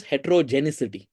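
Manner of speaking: heterogeneity with a stray C, as "hetero-genicity"; common mispronunciation. Shown as a demonstration. Lecturer shows students a common error, mispronouncing heterogeneity as "hetero-genicity". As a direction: end heterogeneity in "-icity" by mistake. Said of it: "a common pronunciation error".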